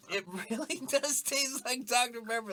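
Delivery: high-pitched, laughing disbelief; amazed laughing. Real, not acted. Tasting the drink with a friend, high laughing amazement. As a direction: say it cracking up in amazement.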